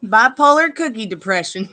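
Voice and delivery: ordinary talk, nothing marked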